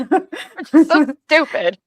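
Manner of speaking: high, slow, laughing mock wail; hammed-up laughing self-blame; laughing self-mockery. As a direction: laugh through a fake lament.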